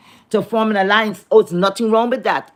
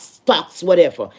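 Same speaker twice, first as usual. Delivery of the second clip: ordinary talk, nothing marked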